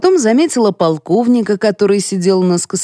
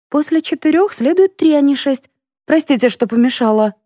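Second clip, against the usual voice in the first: timid high voice; 7 semitones higher than usual. A narrator's reading. Timid Lyusya corrects.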